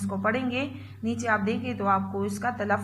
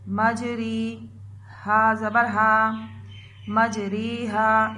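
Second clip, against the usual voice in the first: slow spelling drill; much slower than usual. Shown as a demonstration. Teacher drilling children through a word, slow spelling. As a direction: spell it out slowly so children repeat. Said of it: "इसकी हम, अ, पढ़ाई करते हैं।".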